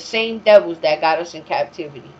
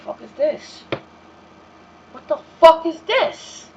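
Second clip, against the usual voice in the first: put-on rising, louder angry challenge to the boyfriend; outraged disbelief; 8 semitones higher, 5 dB louder than usual. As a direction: demand it, scandalized, building up. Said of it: "I said".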